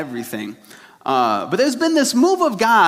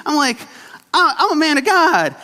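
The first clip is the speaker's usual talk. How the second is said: indignant self-defense; 9 semitones higher than usual. Retold or imitated. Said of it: "I was like".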